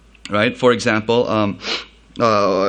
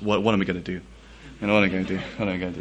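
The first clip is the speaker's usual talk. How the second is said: hushed, worried muttering to himself; retold fourth-grade panic, much softer; panicky muttering; 6 semitones lower, 9 dB quieter, much faster than usual. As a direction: fret quietly, a kid dreading his dad. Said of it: "Just freaking out".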